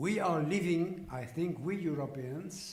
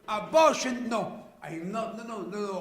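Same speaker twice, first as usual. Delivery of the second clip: emphatic denial; 7 semitones higher, 11 dB louder than usual. Real, not acted.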